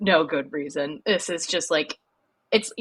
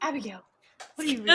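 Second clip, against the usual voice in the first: teacher voice; 7 semitones higher, much faster than usual. Retold or imitated. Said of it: "I just did my teacher voice".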